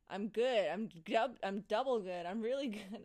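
loud, over-eager imitation; mock insistence. Coworkers answering the same greeting twice, mock insistence.

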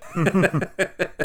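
loud high laugh bursting out; burst of laughter. Real, not acted. Laughing at used car dealer joke.

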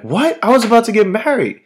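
high, fast disbelief; mock-outraged high protest from the dead groom; shocked dead groom. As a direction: squeak it out in shock.

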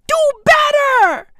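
high-pitched, insistent reprimand; outraged demand. Real, not acted. Comedian repeating his scolding of social media users, high and outraged. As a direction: push it up high in exasperation.

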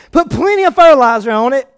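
preaching. Acted. Back to preaching.

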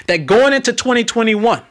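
ordinary talk, nothing marked

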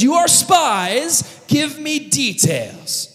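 high, slow, hammered-out demand; mock interrogation. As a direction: grill them like a hammy official. Joseph accuses again, demands details.

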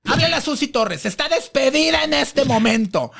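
high, imperious mock firing, slowing down; angry. Diva firing a staffer on the spot, angry. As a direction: imitate a furious boss's order. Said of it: "Está enojada".

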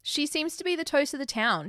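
ordinary talk, nothing marked

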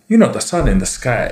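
put-on kids' TV Sun with a Tongan accent. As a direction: imitate Uncle Aaron's Tongan accent as the Sun. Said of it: "He talked like this the whole time".

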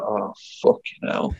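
whispered, slow groan of dismay; hushed curse between two soldiers. Retold or imitated. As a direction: breathe it out under your breath. Dismayed aside to his mate.

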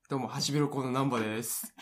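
ordinary delivery

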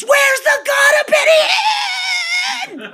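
full-throated high shout; yelling. Retold or imitated. Running into the street in India, shouting at the top of her lungs. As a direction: cry out like a mad woman. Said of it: "yelling at the top of my lungs".

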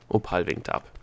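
usual speaking voice

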